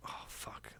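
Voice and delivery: whispered swear under the breath; hushed dismay. Retold or imitated. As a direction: breathe it out in quiet dread. Sees huntsman on car window.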